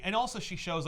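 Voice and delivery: ordinary talk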